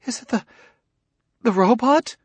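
high, gasping question; gasped. A narrator's reading. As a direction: gasp it out in shock. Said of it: "She gasped".

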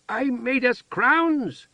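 put-on high, slow childlike voice; proud as a child showing off; excited simple-minded woman. A narrator's reading. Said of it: "Heather shouted".

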